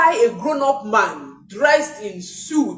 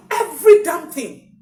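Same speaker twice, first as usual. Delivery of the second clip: tearful emphasis; 7 semitones higher, 7 dB louder than usual. Real, not acted. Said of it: "crying heard".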